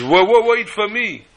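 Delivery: high, slow pleading; pleading call. Acted. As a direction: call after him anxiously. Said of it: "Yetzer Hara turns around and says".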